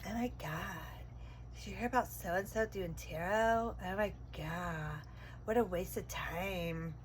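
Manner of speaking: mock gossip. Reader imitating a gossip mocking tarot, low and soft.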